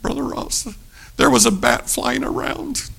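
high, soft imitation of a girl; small, high girlish voice; sweet girl. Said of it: "they were the sweetest things. They said".

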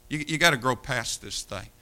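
usual speaking voice

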